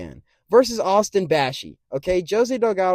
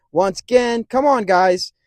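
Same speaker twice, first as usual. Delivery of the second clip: ordinary talk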